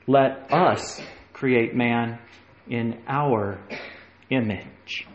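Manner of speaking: "us" stretched, voice falling away; slow emphatic reading. Shown as a demonstration. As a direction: linger on "us" then fade. Hint that God is three.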